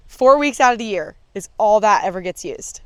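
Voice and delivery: ordinary talk, nothing marked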